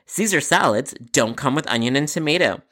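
plain reading voice

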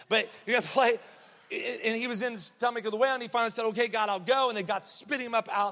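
put-on eager little girl. Little girl telling teacher how Jonah got out, eager and high. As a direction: imitate a child excitedly rattling through her story. Acting girl's eager Bible story.

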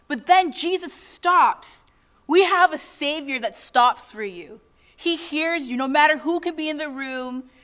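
ordinary delivery

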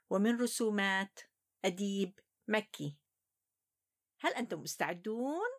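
ordinary delivery

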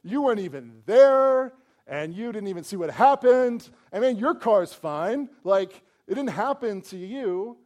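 loud, high, indignant shouting; indignant outburst. Retold or imitated. Driver confronting the stranger who forgave the culprit, loud protest. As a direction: yell at the stranger in outrage. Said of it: "I'd be like".

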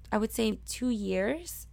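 ordinary talk, nothing marked

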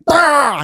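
high, drawn-out mock scream; freak-out scream. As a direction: act out a sudden teenage freak-out yell. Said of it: "I'd freak out".

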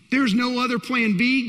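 ordinary talk, nothing marked

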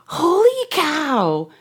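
put-on high, slow marvelling at the girl; amazed. Telling a priest's story, voicing his amazement at the calm girl. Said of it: "And I was like".

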